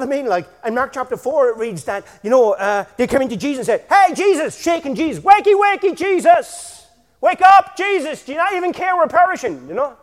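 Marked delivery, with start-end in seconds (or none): 3.9-4.6 loud high cry of a frightened disciple; mock panicked call. Disciples shaking Jesus awake, loud panicked cry. 5.2-9.7 loud, high, desperate wake-up cry; panicked pleading. Acted. Disciples in the storm pleading with sleeping Jesus, loud and high.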